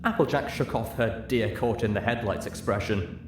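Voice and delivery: plain reading voice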